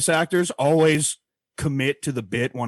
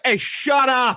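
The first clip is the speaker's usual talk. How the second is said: mock yell; 9 semitones higher, much slower than usual. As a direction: pretend furious shout at a priest. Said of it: "look over and be like".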